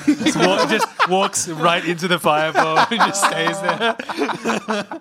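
laughing. Retold or imitated. Acting out a dazed character.

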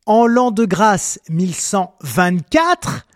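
« vingt-quatre » drawn out with a rising end; slow quoted line. Retold or imitated. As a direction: read it out slow and pointed. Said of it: "ce texte commence par". (citation lente, montée sur la fin)

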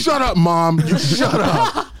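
loud, high retort with laughter; hammed-up drunken backtalk; mock angry shout. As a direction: yell back like a drunk brat, dragging it out. Retold drunken backtalk, acted shout.

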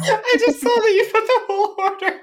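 high, helpless laughter through the words; doubled over laughing. Real, not acted. Podcaster seeing the whole order written out, doubled over laughing. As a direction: squeal it out through uncontrollable laughter. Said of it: "Nate is doubled over in laughter".

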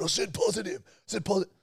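high-pitched mock yell; retold screaming. Complainer imitating his friend's repeated protest, mock screaming. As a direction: mimic someone insisting shrilly on "positive". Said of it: "You're just screaming at me".

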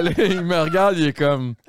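ordinary talk

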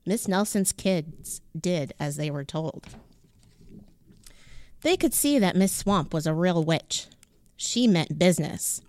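ordinary delivery

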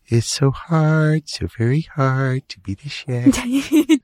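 high, drawn-out self-pitying dog voice; milk it slowly, high and long-suffering; weary put-upon dog. Acted. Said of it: "She's like".

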